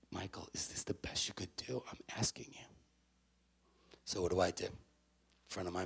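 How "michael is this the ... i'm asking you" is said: near-whispered motherly appeal; hushed sincere question. Retold or imitated. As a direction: ask it very softly, truly wanting an answer. Mother asks sincerely after his outburst.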